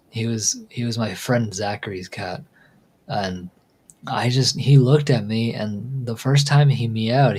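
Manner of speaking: usual speaking voice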